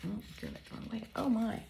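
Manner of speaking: very soft, to oneself; quiet flustered aside; muttered aside. Real, not acted. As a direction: mumble it under your breath. Aside while fumbling the flap.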